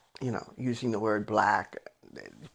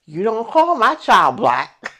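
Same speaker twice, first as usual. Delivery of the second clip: loud, slow, higher furious mother; angry mother; 8 semitones higher, 10 dB louder than usual. Retold or imitated. Mother scolding the teacher, loud slow fury. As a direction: lay down the law, slow and loud. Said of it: "mother confronts teacher at school".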